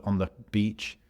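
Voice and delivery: ordinary delivery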